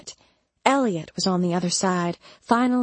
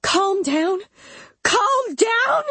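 very high, rising shrill questions; shrill outrage; 14 semitones higher, 4 dB louder, much slower than usual. A narrator's reading. Mama rejecting Elliot's plea to calm down, shrill. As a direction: throw it back shrilly, climbing on the repeat. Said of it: "her voice shrill".

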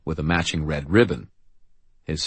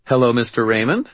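higher, louder cheerful hello; bright greeting; 7 semitones higher, 5 dB louder than usual. A narrator's reading. Young woman greets the guard, bright and high. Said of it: "Sarah said brightly".

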